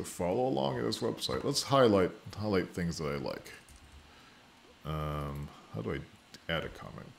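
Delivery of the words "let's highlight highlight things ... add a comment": normal talking voice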